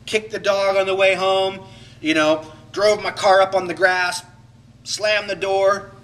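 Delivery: hammed-up angry boasting; mock venting. Shown as a demonstration. Acting out unhealthy anger expression, loud and brash. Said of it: "I expressed my anger".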